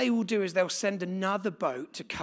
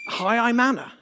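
bright, louder mock self-introduction; playful imitation of a voice note; imitating a recorded message; 6 semitones higher, 9 dB louder than usual. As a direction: parrot the phone message, upbeat. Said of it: "Anna's phone is just kicking off".

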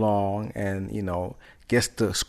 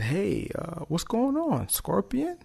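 high, soft storybook frog voice; frog greeting; 10 semitones higher, 6 dB quieter, slower than usual. Acted. Frog asks the stranded scorpion what's wrong, soft frog voice. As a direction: gently pitch it up for a curious question.

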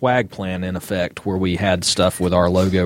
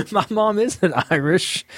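laughing through the words, higher and slow; 10 semitones higher, slower than usual. Real, not acted. Host laughing back at co-host's your mom joke. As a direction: giggle it out in disbelief.

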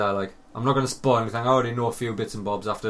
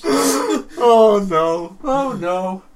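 loud, high cries of dismay, half laughing; mock mortified wail; 9 semitones higher, 10 dB louder, slower than usual. Real, not acted. Host realizing the accent joke backfired, loud mock wail. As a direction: wail it like it's all gone wrong.

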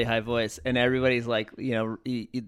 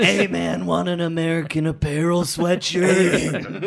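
louder, slow hammed-up street hustler; loud slow hobo pitch; 5 semitones higher, 5 dB louder, slower than usual. Joining the riff on the apartment hobos, loud slow hustler pitch. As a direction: bellow the sales pitch like a hustler. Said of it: "riffing on hobo impressions".

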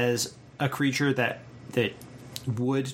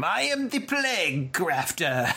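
slow, high cartoon villain voice; 5 semitones higher, slower than usual. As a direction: ham up a cartoon bad guy. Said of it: "cartoon villain name".

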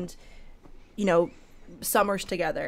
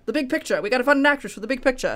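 put-on fast transatlantic accent, old Hollywood producer; 5 semitones higher, 5 dB louder, faster than usual. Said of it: "he's got a very transatlantic accent".

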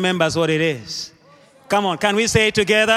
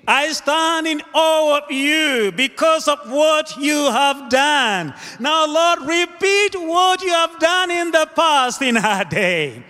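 raised pitch, impassioned plea to God; fervent preached prayer; 7 semitones higher than usual. Acted. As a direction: cry out to the Lord like a preacher. Prays at full force.

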